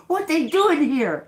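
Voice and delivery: high, loud imitated outrage; mock angry man. Retelling the man walking through Rome, mock mad. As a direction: act out his indignation at full pitch. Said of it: "He's mad".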